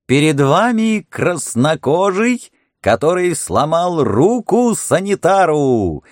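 high, slow fairground barker; booming circus ringmaster. Acted. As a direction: present the exhibit like a showman. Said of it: "заводил Хардинг".

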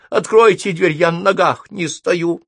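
high, loud cat's plea; pitiful cat. A narrator's reading. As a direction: beg to be let in, high and worn out. Exhausted cat begs at door. (жалобная кошачья мольба высоким голосом)

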